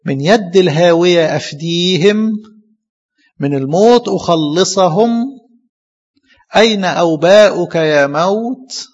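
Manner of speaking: slow, drawn-out verse reading; slow solemn chant of the prophecy; solemn scripture recitation. Acted. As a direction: intone the verse slowly and grandly.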